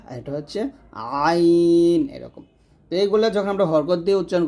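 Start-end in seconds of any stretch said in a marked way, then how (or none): 1.3-2.0 long held "আইন" squeezed from mid-throat; pressed. Shown as a demonstration. Teacher demonstrating a throat letter, long pressed "আইন". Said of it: "মাঝখান থেকে করব".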